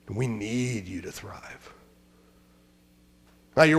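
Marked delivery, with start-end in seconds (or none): 0.1-1.7 hushed, slow plea; a soft whispered appeal to the church. Real, not acted. Quiet plea to congregation.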